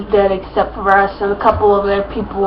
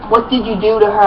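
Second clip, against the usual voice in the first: plain reading voice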